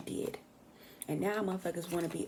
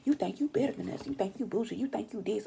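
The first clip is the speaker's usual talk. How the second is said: mocking jealous folks; 2 semitones higher than usual. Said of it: "they feel like".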